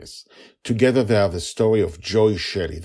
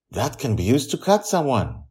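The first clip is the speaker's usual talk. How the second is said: put-on lightly raised boy voice; a boy pointing out danger, a bit higher; child's voice; 8 semitones higher, 4 dB louder than usual. A narrator's reading. Charlie voiced in story.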